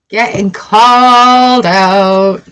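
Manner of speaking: slow, drawn-out, loud tease; playfully called out. Real, not acted. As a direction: stretch it out, amused at being caught. Teasing self after reading.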